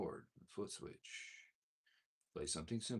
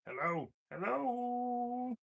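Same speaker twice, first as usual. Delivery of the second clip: pedal effect; 6 dB louder, much slower than usual. Real, not acted. Testing mic through the pedal.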